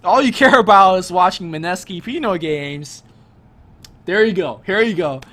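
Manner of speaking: loud teasing call-out. Real, not acted. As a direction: call it out to the viewers. Teasing Pinoy viewers, calling out.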